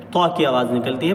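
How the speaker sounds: normal talking voice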